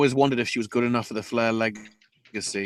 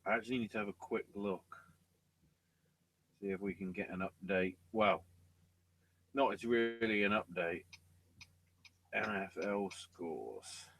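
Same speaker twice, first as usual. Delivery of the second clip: much softer, talking to himself; muttered aside; 10 dB quieter than usual. Real, not acted. Checking the game scores mid-show, a soft aside to himself. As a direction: mumble it while checking his phone.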